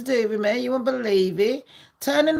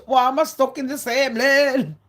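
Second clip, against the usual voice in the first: raised, louder imitation of a moaner; mocking complaint; 5 semitones higher, 4 dB louder than usual. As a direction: complain loudly, sending him up. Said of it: "chatting shit and going".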